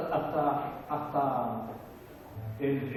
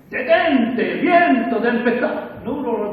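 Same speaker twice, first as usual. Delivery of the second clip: loud, high command to the storm; commanding rebuke; 7 semitones higher, 12 dB louder, much slower than usual. Pretend. Jesus ordering the storm to stop, loud and commanding. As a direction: rebuke the wind with full authority. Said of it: "él dijo, por decir una cosa".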